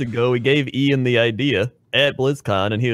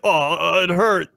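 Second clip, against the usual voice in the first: higher, whining ogre; a hurt dumb ogre; dim giant; 6 semitones higher, much slower than usual. Acted. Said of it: "do your ogre voice".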